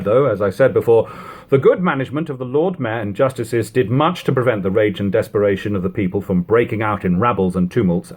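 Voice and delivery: ordinary delivery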